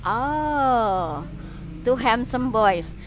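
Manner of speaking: ordinary talk, nothing marked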